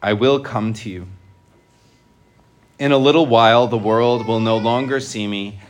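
measured, solemn liturgical reading; gospel proclamation. Real, not acted.

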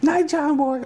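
high sing-song Waltons voice; Waltons goodnight. Retold or imitated. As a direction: call out like the Waltons family. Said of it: "going back to the Waltons".

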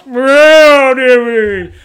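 loud, high plummy shout of dismay; posh gent exclaiming. Retold or imitated. Dad imitating the posh character's loud dismay. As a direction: cry out like a flustered toff. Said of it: "Like that".

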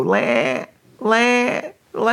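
high, drawn-out bleating wail; baby lamb cry. Retold or imitated. Dad recalling his newborn's cry, pitiful imitated bleat. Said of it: "just like a little baby lamb crying".

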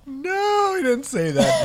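high laughing protest; real laughter breaking into words; laughing disbelief. Real, not acted. Laughing disbelief at friend's story.

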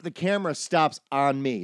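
ordinary talk

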